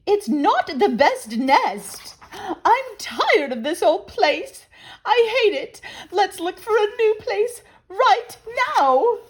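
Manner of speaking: high, loud complaining bird voice; squeaky high grumbling Missus Bird; fussy Missus Bird. Acted. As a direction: whine it as a discontented wife bird.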